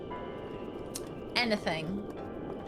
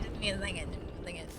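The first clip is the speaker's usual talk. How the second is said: softer and lower each time, fading out; trailing off; 5 semitones lower, 12 dB quieter, much faster than usual. Real, not acted. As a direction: mumble it away to yourself. Stalling, trailing off.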